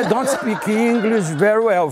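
high, slow, halting English; he tells the guards, high and slow; timid foreigner. Retold or imitated. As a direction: apologise in shaky broken English. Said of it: "Eu falei".

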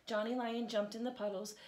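ordinary delivery, nothing marked

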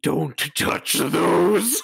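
slow, creepy crone warning; creepy witch voice. Acted. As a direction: warn them slowly like a witch. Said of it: "that creepy voice you were doing".

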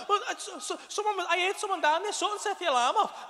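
high, stammering worried reply; mock anxious explanation from upstairs; imitating flustered partner.

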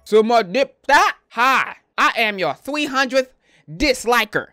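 hammed-up, stilted comment reading; mock commenter voice.

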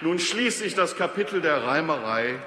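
mock-heroic declamation of a rhymed poem; verse recitation. Speaker mocks the opposition's motion in Faust-like rhymes, declamatory verse. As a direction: declaim each couplet, lifting the openings and letting line ends fall.

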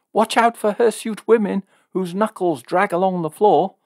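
high, loud taunting call. A narrator's reading. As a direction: sing it out after someone leaving. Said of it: "called out Jill".

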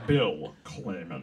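low, slow, drawn-out movie villain; slow and low, savouring each syllable; Alan Rickman. Acted. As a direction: stretch the name out in a deep villain voice. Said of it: "That's my Alan Rickman".

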